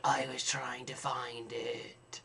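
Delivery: whispered, slow and faint; feeble whisper. Retold or imitated. Dehydrated on the kitchen floor, answering his partner in a faint slow whisper. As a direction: breathe it out like you're dying on the floor.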